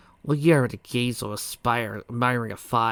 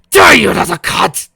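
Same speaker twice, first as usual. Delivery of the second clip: enraged curse; 9 semitones higher, 16 dB louder than usual. Acted. Villain cursing in rage.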